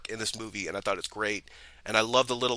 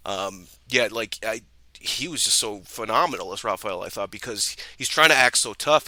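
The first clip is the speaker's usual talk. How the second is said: ordinary talk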